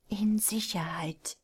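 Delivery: whispered, slow and breathy; soft reply. Acted. Unseen woman answering the frightened prisoner in a slow whisper. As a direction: answer him softly through the speaker. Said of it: "klang es leise zurück".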